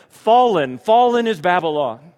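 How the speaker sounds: loud, high proclamation of fallen; ringing prophetic cry; proclaimed. Acted. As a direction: cry it out like a herald. Climactic proclamation of the vision.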